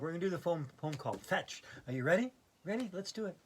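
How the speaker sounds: ordinary talk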